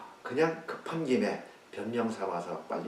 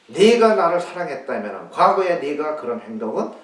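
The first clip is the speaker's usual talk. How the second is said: loud, high scolding; rebuke; 6 semitones higher, 15 dB louder than usual. Acted. Jesus reproaching Peter for his past deeds, loud scolding. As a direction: hammer home his past failure. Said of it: "책망입니다".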